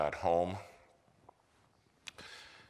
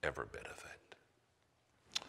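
slow whisper, much softer; whispered aside; 5 semitones lower, 10 dB quieter, slower than usual. Real, not acted. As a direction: breathe it out slowly. Preacher's hushed aside.

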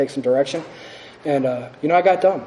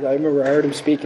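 usual speaking voice

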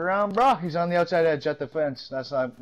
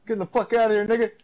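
hyped taunt; 7 semitones higher, 6 dB louder than usual. Real, not acted. Taunt after a kill.

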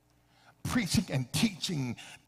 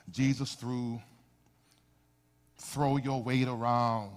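low, softer, measured praying voice; prayer; 6 semitones lower, 4 dB quieter than usual. Real, not acted. Preacher praying to Jesus before the message, hushed prayer. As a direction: pray it low with long pauses between phrases.

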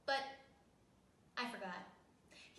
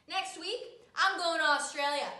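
defiant retort; 5 semitones higher, 10 dB louder than usual. Acted.

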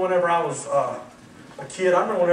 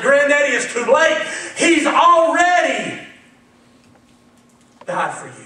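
tearful plea; 8 semitones higher, 10 dB louder than usual. Retold or imitated. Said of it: "with tears in my eyes".

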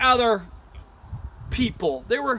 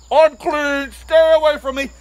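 loud, raised outcast's cry; mock leper's yell; yelled warning; 5 semitones higher, 11 dB louder than usual. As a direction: bellow a warning to keep back. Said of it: "instead of yelling".

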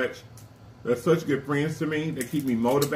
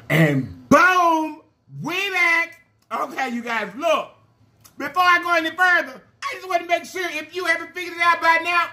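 hyped comeback; 13 semitones higher than usual. Real, not acted. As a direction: announce the return with big energy. Bursting back on camera to viewers.

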